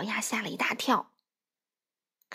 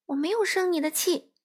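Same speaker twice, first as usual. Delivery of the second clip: higher, huffy denial; mildly huffy storybook denial; 8 semitones higher than usual. Acted. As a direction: sulk it out. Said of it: "佛洛格气呼呼地说".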